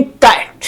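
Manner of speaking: कैट in one single beat; one syllable. Shown as a demonstration. Teacher contrasting it with table, said as one syllable. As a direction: say कैट in one unbroken push. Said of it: "एक ही बार में हमने बोल दिया".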